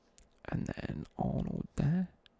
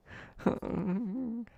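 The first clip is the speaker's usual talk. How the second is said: nervous high laugh; 10 semitones higher than usual. Retold or imitated. Caught passing notes.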